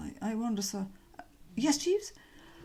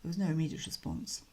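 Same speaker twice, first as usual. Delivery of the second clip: plain reading voice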